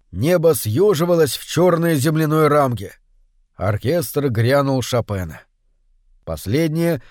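ordinary delivery

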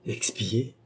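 murmuré tout bas, presque pour soi; un murmure interrogatif, à peine dit; murmured. A narrator's reading. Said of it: "murmura Gilbert". (murmure hésitant)

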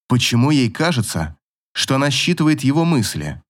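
plain reading voice

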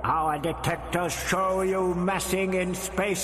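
high, slow, hollow threat; final warning, menacing; thundering alien voice. A narrator's reading. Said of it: "the voice thundered".

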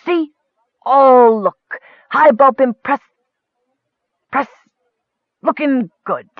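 high, loud, show-off ruler; demanding self-proclaimed king. A narrator's reading. Dwarf leader showing off his elk suit, demanding. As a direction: crow it loudly like a pompous little king. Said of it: "He strutted around in a circle, demanding".

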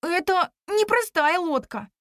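high, drawn-out excitement; excited exclamation. A narrator's reading. Companion recognising the boat, excited. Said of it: "воскликнул он".

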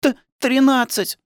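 put-on very high child's voice, slow and stuttering; frightened stammer. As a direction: stammer the answer like a scared little boy. Scared boy stammers answer to father.

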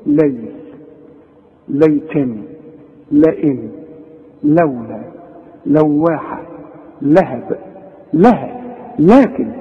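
"ليل" with a thin, clear L; unhurried list, each L light as in "لولا"; correct light Lam. Shown as a demonstration. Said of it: "مرققة دائما". ("لولا" بلام مرققة)

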